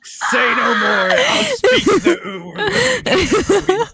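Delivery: loud, high, drawn-out squeal; mock-eager shout. Comically eager shouted acceptance of uwu.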